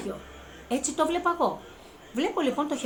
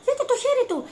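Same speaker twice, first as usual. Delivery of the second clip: excited pleading; 13 semitones higher, 5 dB louder, faster than usual. Retold or imitated. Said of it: "Τους έλεγα".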